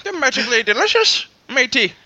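put-on playful high leprechaun voice in an Irish accent; mock Irish leprechaun. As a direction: ham up a cartoon Irish leprechaun. Joining the leprechaun bit.

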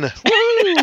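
high-pitched woo of triumph; celebratory whoop. Real, not acted. Host cheering the exclusive scoop, high whoop.

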